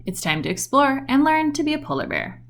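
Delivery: ordinary delivery, nothing marked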